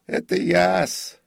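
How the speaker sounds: put-on high, loud, drawn-out old man; prompter's voice. A narrator's reading. Prompter approaches the slumped comic, high voice. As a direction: announce yourself in a high, slow call.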